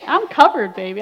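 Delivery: I'M punched loud: "I'm covered" as a proud crow; loud triumphant boast. Retold or imitated.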